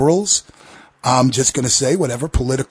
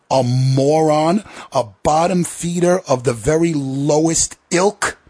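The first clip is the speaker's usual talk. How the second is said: ordinary talk, nothing marked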